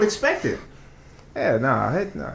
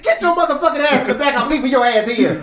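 mock angry order; 8 semitones higher than usual. Voicing himself yelling at ex.

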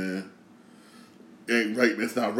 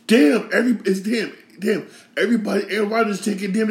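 exasperated outburst; 7 semitones higher, faster than usual. Real, not acted. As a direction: blurt it out in disbelief. Outburst at Rodgers taking everyone.

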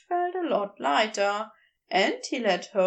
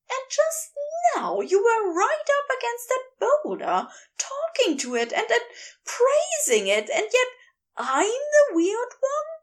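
higher, heated fussy angel voice; Aziraphale, wild; 11 semitones higher than usual. A narrator's reading. Angel ranting at the geologist, high and heated. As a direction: raise the pitch and let him rant.

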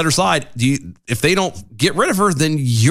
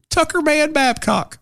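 high, slow, put-on Tucker Man Babcock; silly name voice; 13 semitones higher, slower than usual. Host playing with a funny name, silly name voice. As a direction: sing out the name high and drawn out.

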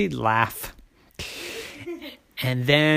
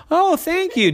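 grateful dad; 13 semitones higher than usual. A narrator's reading. Grateful daddy voiced.

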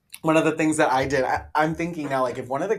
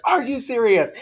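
shocked teacher; 10 semitones higher, 6 dB louder than usual. Retold or imitated. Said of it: "she was like".

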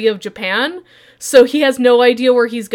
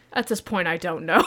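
low, soft, deadpan resignation; resigned Maeda; 6 semitones lower, 8 dB quieter than usual. Retold or imitated. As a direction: admit it quietly, giving up.

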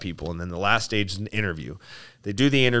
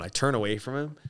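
ordinary delivery

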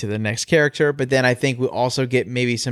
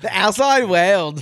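high, slow mock Australian drawl; playful fake Australian accent; 6 semitones higher, slower than usual. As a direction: stretch it out like a jokey Australian narrator. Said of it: "I can't do an Australian accent".

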